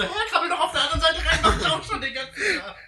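high, loud, laughing retort; laughing quote. Retold or imitated. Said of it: "Und ich so".

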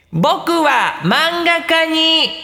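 put-on child declaring dream. Child shouting dream at ceremony.

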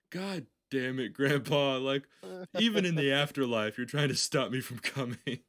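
loud, high comic complaint; hammed-up outrage at grandpa; mock exasperation. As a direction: rail at grandpa in pretend frustration. Acts scolding grandpa for interrupting.

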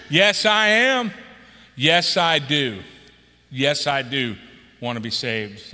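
deliberate, sinking affirmation; slow, fading answer. Shown as a demonstration. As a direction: say yes slowly and earnestly. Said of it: "preacher models the right answer".

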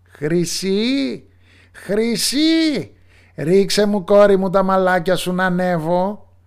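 high, loud witch call. Acted. Witch calls up to her daughter in the tower, high and loud. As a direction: sing it up to the tower window like a witch. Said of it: "ακούστηκε η μάγισσα να φωνάζει".

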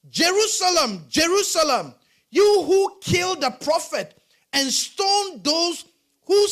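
a grieving God calling out to Jerusalem; God's lament. Acted. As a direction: plead with a lost city.

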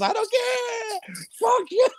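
very high, drawn-out mock shout; defiant Joker. Joker to gangsters as money burns, defiant shout. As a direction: yell it like a gleeful madman. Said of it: "He goes".

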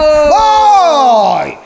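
long, loud, high "voy"; starting shout. Real, not acted. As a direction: bellow it out to launch the round. Shouts to start his game turn. (grito largo de arranque)

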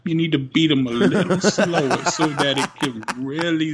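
hammed-up mock promo from a simple-minded father; silly put-on voice, close to laughing. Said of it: "I don't know why he's talking like this".

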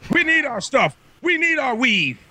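high mocking voice of the woman; mocking quote. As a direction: ham up her plea for weave. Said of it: "She said".

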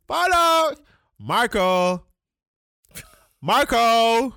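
put-on high, loud calling for the lost child. Mother calling out for her missing son. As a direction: shout the name, searching. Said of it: "Accent and all".

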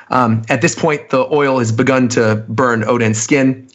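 ordinary delivery

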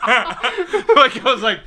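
high helpless laughter; laughing. Real, not acted. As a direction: laugh through the words. Laughing through own story.

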